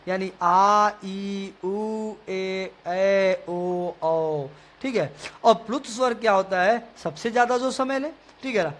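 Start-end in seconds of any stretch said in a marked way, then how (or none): 0.4-4.5 आ, ई, उ, ए, ऐ, ओ, औ each sounded out; teacher reciting long vowels; long vowels recited. Shown as a demonstration. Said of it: "दीर्घ स्वर है।".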